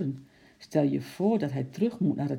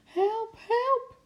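very high cry for help; panicked cry. A narrator's reading. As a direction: squeal for help while fleeing. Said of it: "gillend rende ze de trap af". (angstig gillend om hulp)

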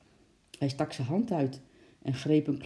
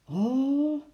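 dismayed oh; 9 semitones higher than usual. A narrator's reading. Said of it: "zei hij voor de derde keer".

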